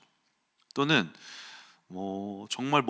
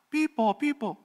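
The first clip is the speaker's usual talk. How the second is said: high mock siren wail; a playful siren sound; imitated siren; 13 semitones higher, much slower than usual. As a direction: sing out a police siren. Police car arriving, imitated siren.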